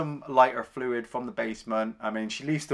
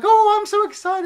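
much higher, louder squeal of glee; giddy Annie; 9 dB louder than usual. Retold or imitated. Imitating Annie, gushing to Paul over champagne, high squealing glee. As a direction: gush like a thrilled superfan. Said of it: "she's like".

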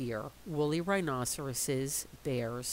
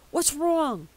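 alarmed mother; 11 semitones higher, 7 dB louder than usual. Acted. Mother woken.

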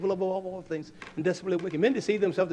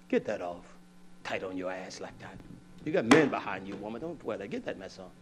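husband's low orders; 6 semitones lower than usual. Retold or imitated. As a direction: tell her flat and low. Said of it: "if I tell her".

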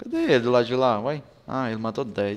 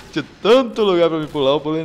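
words squeezed out through laughter, louder; laughing while talking; 6 semitones higher, 11 dB louder, faster than usual. Real, not acted. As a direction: laugh through the line at your own blunder. Laughing at jumping into enemy's aim. (falando rindo de si mesmo, mais alto)

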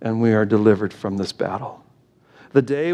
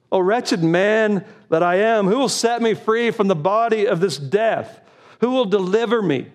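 anguished cry; 8 semitones higher than usual. Acted. Preacher cries out Paul's lament.

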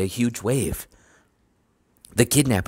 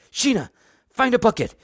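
high-pitched frantic orders; high shouts, building louder; urgent yell; 14 semitones higher, 5 dB louder than usual. A narrator's reading. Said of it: "he yelled".

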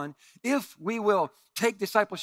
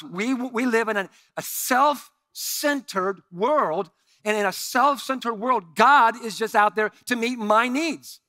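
louder, higher slow preaching; 5 semitones higher, 8 dB louder, slower than usual. Real, not acted. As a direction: press the point with force. Preaching climax.

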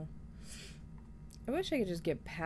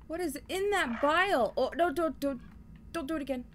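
being chased; 7 semitones higher than usual. Real, not acted. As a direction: plead in a panic. Pleading with enemy.

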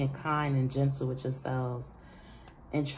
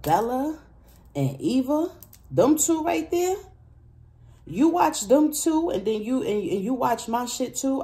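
excited praise; 9 semitones higher, 5 dB louder than usual. Real, not acted. Excited praise of favourite readers.

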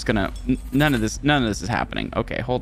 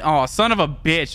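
ordinary talk

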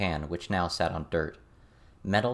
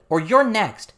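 loud, high-pitched threat; furious yell, pitched way up; shouting; 10 dB louder, slower than usual. A narrator's reading. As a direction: shout an angry warning. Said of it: "Lucy shouted".

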